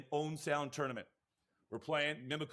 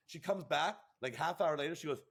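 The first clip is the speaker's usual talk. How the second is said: ordinary talk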